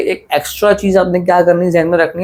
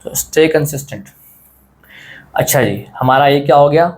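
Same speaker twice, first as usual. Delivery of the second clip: normal talking voice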